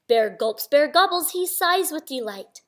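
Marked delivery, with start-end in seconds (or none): none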